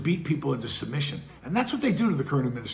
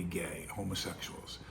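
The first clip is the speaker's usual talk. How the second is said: whispered aside; 7 semitones lower, 13 dB quieter than usual. Real, not acted. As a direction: drop to a breathy whisper for one word. Whispering a sensitive word aside.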